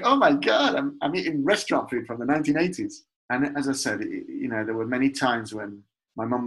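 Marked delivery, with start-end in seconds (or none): 0.0-3.0 high, loud burst of delighted awe; mock amazement. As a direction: gush it, starting high and loud, then racing on. Acting out a delighted diner's thought.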